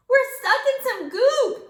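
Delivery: high, loud panicked yell. A narrator's reading. Ralphie yelling to the class, stuck in goop, loud yell. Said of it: "Ralphie yelled".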